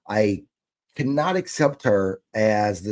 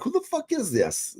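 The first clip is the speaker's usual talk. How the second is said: high, incredulous who-is-this; baffled outburst; 5 dB quieter than usual. Retold or imitated. Podcaster replaying his reply to a strange text, high and baffled. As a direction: squeak it out in disbelief.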